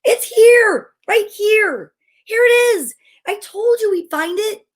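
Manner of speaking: high-pitched, excited calling out; overjoyed child. A narrator's reading. Child shows the family the tree they found, overjoyed. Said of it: "yelled an overjoyed child".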